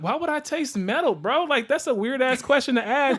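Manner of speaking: high-pitched, baffled retort; retold incredulity. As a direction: act out being thrown by an odd question. Retells baffled reply to odd question.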